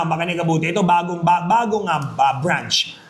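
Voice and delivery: usual speaking voice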